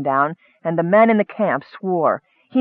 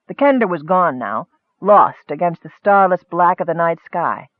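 ordinary delivery

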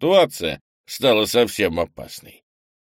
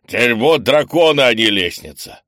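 loud angry curse; angry swearing; 4 semitones higher, 8 dB louder than usual. Acted. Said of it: "выругался Крис".

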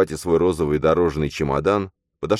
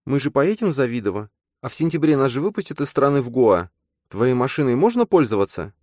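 demanding; 9 semitones higher than usual. Acted. Nastya pressing about plans.

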